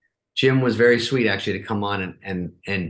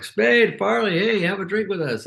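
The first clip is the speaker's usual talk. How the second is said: put-on drunk fans calling out; 11 semitones higher than usual. New York fans calling out to the comedians, high mock shout. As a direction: hail your buddies from across the street. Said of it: "people are like".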